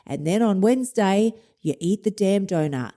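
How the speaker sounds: normal talking voice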